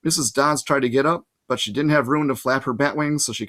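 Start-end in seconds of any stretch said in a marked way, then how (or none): none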